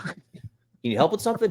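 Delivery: high, forced polite question; hint at him to go away; strained politeness. Retold or imitated. Asking the hovering coworker.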